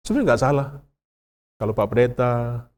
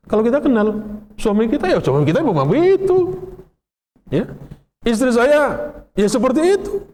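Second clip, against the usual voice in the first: much higher, breezy voice of an easygoing church member; accepting church member; 9 semitones higher than usual. Retold or imitated. Congregant describing a familiar fellow member, high and breezy. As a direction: play the tolerant churchgoer shrugging it off.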